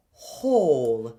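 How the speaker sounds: "whole" beginning on an aspirate h with dark O; h start, dark O. Shown as a demonstration. Coach models whole with aspirate h. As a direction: breathe the h into "whole". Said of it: "it's followed by an H".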